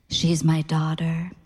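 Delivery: slow, breathy near-whisper. A narrator's reading. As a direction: breathe it out slowly, flirty. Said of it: "said to Ray in a breathy voice".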